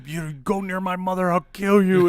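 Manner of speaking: high, building to a loud mock yell; retold angry threat. Friend defending his mother in an argument, mock furious.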